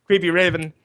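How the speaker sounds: ordinary delivery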